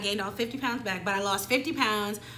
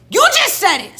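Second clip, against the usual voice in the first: high, very loud accusation; re-enacted outraged outburst; angry shout; 11 semitones higher, 14 dB louder than usual. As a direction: yell it back at him, exasperated. Acted quarrel, catching him out.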